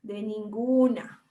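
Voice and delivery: a slow, drawn-out coastal Ecuadorian De ninguna; slow coastal Ecuadorian drawl; coastal Ecuadorian accent. Retold or imitated. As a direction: imitate a coastal Ecuadorian speaker, unbothered. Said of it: "Como dicen los costeños".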